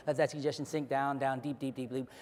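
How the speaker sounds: soft sinking hypnotist voice; hypnotic induction. Acted. Hypnotist sealing a suggestion, soft sinking voice. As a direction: let it sink down quietly.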